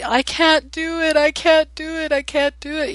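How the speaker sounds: imitating crying child. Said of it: "through his tears".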